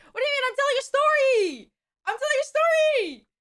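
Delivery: high, whiny outcry; indignant protest. Real, not acted. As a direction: wail back at the hecklers. Protesting chat's jab at her monologue.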